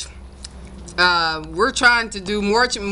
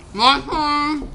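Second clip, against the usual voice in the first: muffled through a full mouth; cheeks stuffed full; 7 semitones higher than usual. Real, not acted. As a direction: mumble it with cheeks crammed. Stuffed mouth, chubby bunny.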